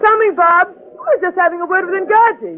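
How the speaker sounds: shouting back up the ladder; calling back. Acted. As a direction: call up to your husband.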